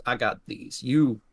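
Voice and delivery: lightly voiced outlaw giving orders; older robber handing out a job; older man. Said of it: "looks back to his companion and goes".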